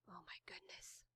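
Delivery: breathless whispered awe. Real, not acted. Reader whispers in awe as the pen rises. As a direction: hush it like a secret wonder.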